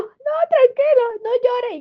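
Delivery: high, loud, slow teary squeak of a voice; imitating her tearful self. Retelling to her friend how she answered him through tears, tearful squeak. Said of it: "y como que yo lloro más".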